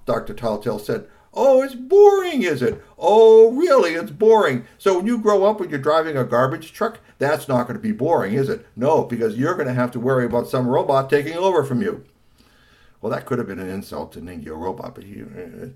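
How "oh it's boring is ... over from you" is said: loud, mocking fatherly rant; sarcastic scolding. Father mocks son's homework complaint, sarcastic scolding. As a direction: start high and incredulous, stay loud.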